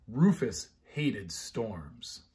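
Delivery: usual speaking voice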